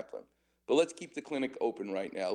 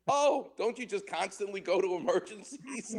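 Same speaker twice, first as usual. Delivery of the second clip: naive surprise; 7 semitones higher than usual. Retold or imitated. Mocking his naive younger self.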